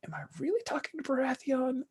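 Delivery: high, hushed voice, lightly put on; quick, soft mock disbelief; imitating his wife. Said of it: "My wife always sees me this, like".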